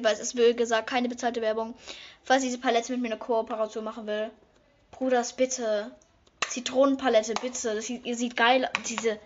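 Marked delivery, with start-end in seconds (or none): none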